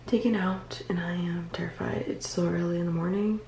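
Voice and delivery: soft tired whisper, nearly voiceless. Real, not acted. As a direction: whisper it, frightened and worn out. Early-morning pre-surgery clip, whispering.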